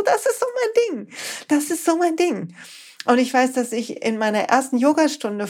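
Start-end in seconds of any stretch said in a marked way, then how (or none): 0.0-2.5 high-pitched, thrilled inner voice; delighted realization. Retold or imitated. Speaker recalls her first coaching session, delighted realization. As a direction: relive the thrill in a much higher pitch.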